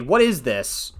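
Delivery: usual speaking voice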